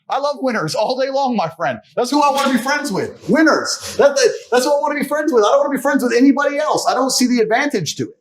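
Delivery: pumped, rallying the listener; fired up. Real, not acted. Guest preaching about loving winners, fired-up rant.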